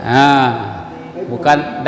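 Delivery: ordinary talk, nothing marked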